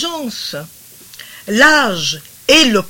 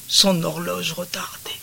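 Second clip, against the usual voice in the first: softly whispered repeat; 7 dB quieter than usual. Shown as a demonstration. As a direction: breathe the phrase as a secret.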